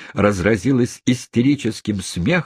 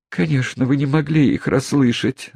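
bashful; 7 semitones higher than usual. Acted. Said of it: "стыдливо сказала она".

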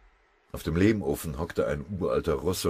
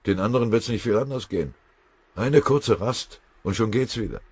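insistent pleading; 7 semitones higher, 6 dB louder than usual. A narrator's reading. Exhausted soldier begs for rest.

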